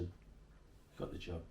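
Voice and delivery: slow hushed confidence. Retold or imitated. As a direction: lean in, tell it quietly and slowly. Boss confides job offer.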